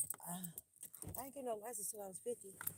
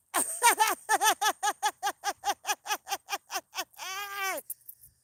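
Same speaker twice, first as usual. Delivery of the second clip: long, high, loud burst of laughter; loud howling laugh; 11 semitones higher, 20 dB louder than usual. Real, not acted. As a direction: crack up hard and loud. Laughs after hitting curb.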